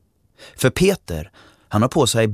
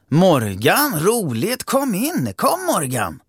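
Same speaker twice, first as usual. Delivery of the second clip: high, eager, overly friendly welcome; welcoming mother; 11 semitones higher, slower than usual. Acted. Mother eagerly welcomes the poor boy inside, high and sweet. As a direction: gush a little as you invite him in.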